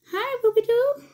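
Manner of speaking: high, loud sing-song hello to the pet; high-pitched pet voice. Real, not acted. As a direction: coo a greeting up high. Greeting pet in baby talk.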